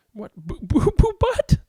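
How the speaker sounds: high whispered disbelief; shocked whisper. Acted. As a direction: whisper it in horror. Shocked at silly name.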